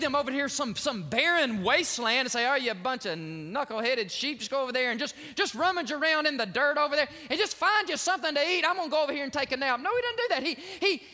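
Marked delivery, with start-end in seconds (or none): 2.5-9.8 mock careless shepherd, faster; lazy shepherd. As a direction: toss off the lazy shepherd's words.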